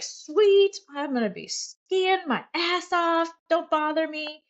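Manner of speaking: imitating a thrilled teenage son; excited son. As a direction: cheer like a kid who just got a ski pass. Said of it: "he was like".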